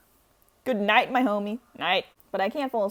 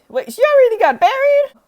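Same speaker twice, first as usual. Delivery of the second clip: much higher and louder disbelief; shocked; 14 dB louder than usual. Real, not acted. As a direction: blurt out in shock. Shocked grandma already buried.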